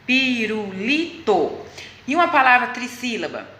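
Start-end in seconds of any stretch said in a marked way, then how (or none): 0.1-1.7 "Pirulito" very slowly, in four separate syllables. Shown as a demonstration. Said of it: "ela tem quatro sílabas". ("Pirulito" bem devagar, sílaba por sílaba)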